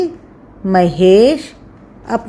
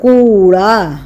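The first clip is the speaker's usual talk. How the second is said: slow teaching words; slower than usual. Shown as a demonstration. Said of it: "इसके शब्द होते हैं".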